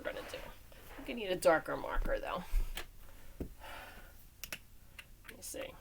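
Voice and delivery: whispered aside to herself; quietly to herself while hunting a marker. Real, not acted. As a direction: breathe it under the breath. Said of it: "muttering to herself".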